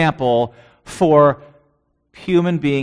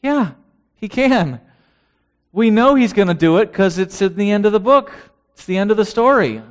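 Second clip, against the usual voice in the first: excited; 5 semitones higher than usual. Real, not acted. Emphatic preaching burst.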